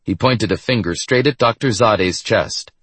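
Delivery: ordinary delivery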